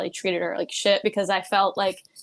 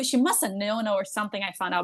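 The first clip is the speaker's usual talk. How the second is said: usual speaking voice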